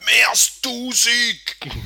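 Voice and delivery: loud, high, drawn-out shout; exclaimed answer. Acted. Co-host answering the host's question on car count, shouted out. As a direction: belt out the figure slowly and triumphantly.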